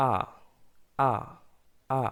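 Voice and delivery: আ with no "r" at the end, British style; আ held open in the British way, dropping the "r"; British আ, a long "ah" with the "r" silent. Shown as a demonstration. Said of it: "ব্রিটিশরা আর উচ্চারণ করে এভাবে".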